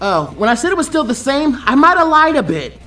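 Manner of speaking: put-on high, loud teasing girl voice; Tenten taunting. Tenten taunts Naruto while throwing weapons, high and cocky. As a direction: taunt him from across the stream. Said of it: "she said".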